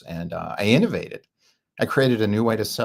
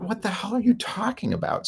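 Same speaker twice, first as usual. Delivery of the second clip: incredulous; 11 semitones higher than usual. Retold or imitated. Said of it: "I'm like".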